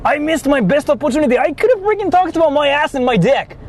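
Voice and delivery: normal talking voice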